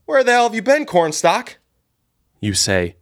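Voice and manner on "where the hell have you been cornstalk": high, angry yell; the boss yells at Zippy for turning up late. Acted. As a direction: bark it out at the late worker.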